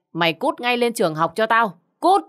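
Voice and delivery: angry dismissal. Acted. Mother sending son back to school, angry dismissal. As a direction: throw him out, punching the final word.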